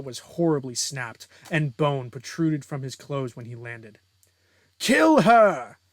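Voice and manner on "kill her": high, loud yelled command; shouted order. Acted. Villain calling on his allies to attack, full shout. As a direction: yell it across the fight.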